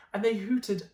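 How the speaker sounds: plain reading voice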